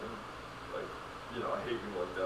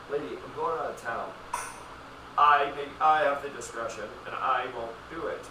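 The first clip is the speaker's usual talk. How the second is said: louder, stern refusal; mock stern pharmacist; 3 semitones higher, 7 dB louder than usual. Pharmacist refusing an early refill, loud and stern. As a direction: refuse her flatly and firmly.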